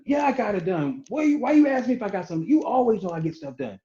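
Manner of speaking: high-pitched indignant comeback; mock-offended, pitched well up; defensive retort. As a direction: act out a put-upon spouse bristling at a question. Acted spat with wife.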